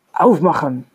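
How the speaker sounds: loud shouted command at the door; German soldier shouting at the family to open up, loud command; barked German order. A narrator's reading. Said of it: "Aan dat bevel".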